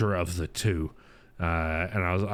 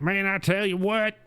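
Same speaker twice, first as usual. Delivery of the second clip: Billy's hillbilly voice; 9 semitones higher than usual. Retold or imitated. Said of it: "Billy's more like".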